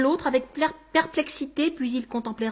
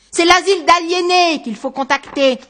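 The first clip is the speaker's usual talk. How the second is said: loud, higher-pitched outburst; exasperated outburst; 6 semitones higher, 10 dB louder than usual. Acted. Man to stranger claiming to be him, exasperated outburst. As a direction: burst out at the madness of it.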